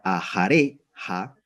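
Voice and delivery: ajareja said slowly, syllable by syllable, stress on re; teacher drawing out ajareja for the class; slow Hebrew word. Shown as a demonstration. Teacher slowly sounding ajareja for students. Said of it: "lleva la mayor tonalidad aquí".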